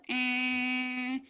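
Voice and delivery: long, steady droning honk; car horn imitation. All she heard for twenty minutes, car horn drone. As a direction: hold one note like a stuck car horn. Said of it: "all I heard was".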